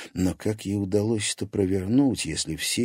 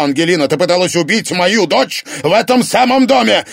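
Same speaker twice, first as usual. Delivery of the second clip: high, loud relentless shouting; furious accusation; 8 semitones higher, 9 dB louder than usual. Acted. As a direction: hammer her with the evidence. Father accuses wife, shouting.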